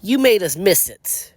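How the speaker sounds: high-pitched annoyed yell. Acted. Deadpool scolding the voices in his head, yell. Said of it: "I yell".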